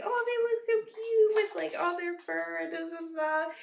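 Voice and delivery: high, gushing mock husband; high-pitched imitation of doting; imitating husband. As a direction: coo over how cute they are, mockingly. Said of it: "My husband's always like".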